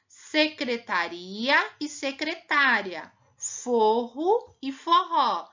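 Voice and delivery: slow pairs with shifting stress, secretária on tá, forró on ró; tônica marcada, devagar; contrasting stress. Shown as a demonstration. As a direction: stress each pair carefully. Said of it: "Mudou totalmente".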